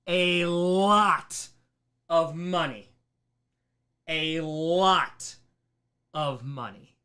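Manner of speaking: slow, hammering "a lot" each time; heavy emphasis. Real, not acted. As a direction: lean hard on "a lot of money". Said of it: "And I'm emphasizing that so much".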